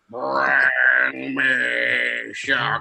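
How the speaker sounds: very slow, heavy, stilted alien voice; slow, menacing creature-in-a-skin delivery; alien-possessed farmer. Retold or imitated. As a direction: drag each word out like a body worn by a bug.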